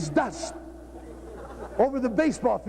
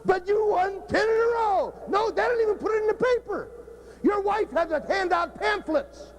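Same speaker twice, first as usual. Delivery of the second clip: heated rant; 7 semitones higher than usual. Real, not acted. Coach ranting at the audience.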